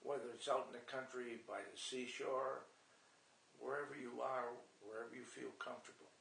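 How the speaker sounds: low, soft, soothing session voice; guided relaxation. Shown as a demonstration. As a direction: ease the listener down into deep relaxation. Demonstrates his guided relaxation session.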